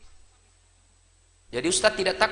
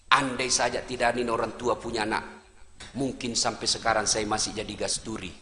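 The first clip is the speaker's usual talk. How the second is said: soft, low thankful prayer; grateful prayer; 14 semitones lower, 9 dB quieter than usual. Pretend. Wife thanking God for her in-laws, soft and grateful. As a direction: pray gratefully and quietly. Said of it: "Ibu sujud syukur".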